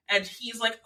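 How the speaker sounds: ordinary talk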